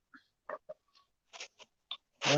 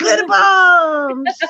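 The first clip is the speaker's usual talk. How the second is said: shout; 10 semitones higher, 10 dB louder, much slower than usual. Real, not acted. Calling out stream catchphrase.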